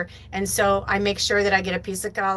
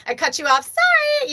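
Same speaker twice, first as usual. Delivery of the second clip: very high, breezy throwaway apology, louder on sorry; flippant sorry; 5 dB louder than usual. Retold or imitated. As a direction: toss off the apology without meaning it. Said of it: "It's not a flippant".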